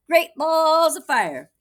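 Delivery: high, loud, slow cry; hatchling hollers its catchphrase; baby goose exclamation. Acted. Baby goose greeting Jack, high and loud.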